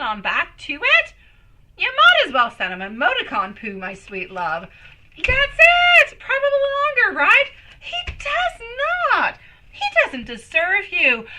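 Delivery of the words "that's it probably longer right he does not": high, loud, laughing outburst; laughing exclaim. Real, not acted. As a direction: squeal it through laughter. Laughs, then exclaims about Alfredo.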